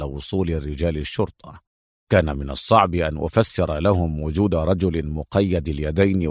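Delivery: low, private inner voice; talking to himself. A narrator's reading. Adham reflects alone on untying the bald man, musing. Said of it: "وقال لنفسه".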